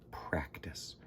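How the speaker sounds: one word, whispered; whispered secret. A narrator's reading.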